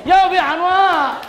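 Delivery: high, drawn-out mock call; impatient calling out. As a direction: play calling to people far behind. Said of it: "D는 거기 청평에 있어". (빨리 오라고 길게 외치는 흉내)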